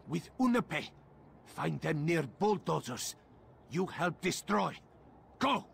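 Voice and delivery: loud orders to go fight; urgent command. Acted. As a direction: bark the orders to send him off. Sending ally into battle.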